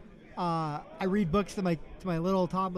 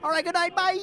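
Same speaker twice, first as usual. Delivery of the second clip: rattled off fast; 2 semitones higher, 6 dB louder, faster than usual. Shown as a demonstration. Dad demos speed-reading the bubbles book to his kids. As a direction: rush through the bedtime book to get out. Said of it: "I just, like, rattle 'em off, like, really quick".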